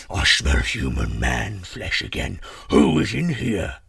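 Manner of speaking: slow, loud giant roar; deep, thunderous monster voice; booming giant. A narrator's reading. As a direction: boom it out as a huge, suspicious giant. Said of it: "he boomed".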